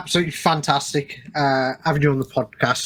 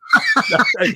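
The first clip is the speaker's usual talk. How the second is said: high bright burst of laughter; amused laugh; 7 semitones higher than usual. Real, not acted. Host laughing at the guest's film choice, high laughter. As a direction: laugh along at the guest's pick.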